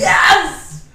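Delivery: loud excited yes; excited shout. Real, not acted. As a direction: burst out with joy. Excited about the song.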